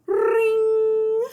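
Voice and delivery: loud high ringing bell. A narrator's reading. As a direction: ring out like a school bell. School bell sound effect.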